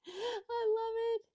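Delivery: high, drawn-out delight after laughing; tickled squeal. Real, not acted. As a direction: squeal it slowly through amusement.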